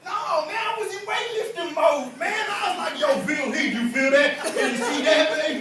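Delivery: high, loud, hyped-up gushing; excited fanboy. Acted. Fan showing off to Phil Heath, loud and giddy. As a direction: gush as if too thrilled to think.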